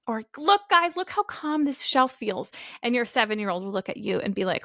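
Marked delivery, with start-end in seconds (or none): 0.4-2.5 mildly excited, high start that slows down; pitched-up show of enthusiasm, easing slower; narrated excitement. Shown as a demonstration. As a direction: invite the family to share your win. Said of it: "we need to narrate our excitement".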